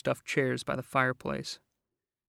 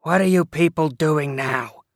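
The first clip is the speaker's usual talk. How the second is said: rasping; 3 semitones higher, 8 dB louder than usual. Acted. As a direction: rasp it out, annoyed. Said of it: "she rasped".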